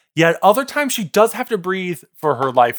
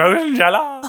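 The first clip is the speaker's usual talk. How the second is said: merchant voice; 7 semitones higher than usual. Retold or imitated. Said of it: "trying to do Potion Seller".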